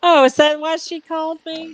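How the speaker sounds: normal talking voice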